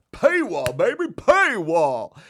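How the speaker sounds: high, loud, drawn-out gloating; mock announcer crowing paywall; gleeful hype. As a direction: crow it like a victory.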